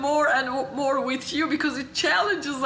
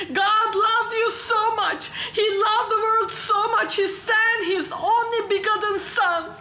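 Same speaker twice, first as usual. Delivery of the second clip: fervent gospel preaching; 4 semitones higher than usual. Retold or imitated. Man preaches to nobody in the dark, fervent proclamation. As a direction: proclaim it at full voice, punching key words. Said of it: "starts preaching the gospel".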